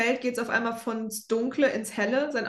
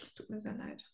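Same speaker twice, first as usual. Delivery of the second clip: breathy whispered apology; unvoiced whisper; 16 dB quieter than usual. Real, not acted. Mock apology after fumbling German.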